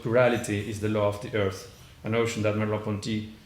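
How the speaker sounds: ordinary delivery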